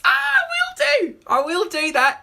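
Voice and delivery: very high, loud squealed reply; shrill burst of delight, easing slightly; excited squeal. Real, not acted. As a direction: squeal your eager agreement. Squealed thanks for a tip.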